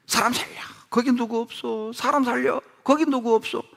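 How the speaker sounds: high, desperate cries for rescue; retold panicked shouts, pitched high; yelling for help. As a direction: call out as if hanging from a cliff. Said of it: "소리를 질렀답니다".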